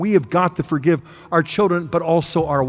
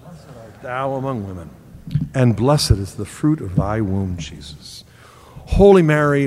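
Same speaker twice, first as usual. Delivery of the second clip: lower, steady recited prayer; 7 semitones lower than usual. Acted. Preacher leading congregation in the Our Father, devout prayer voice.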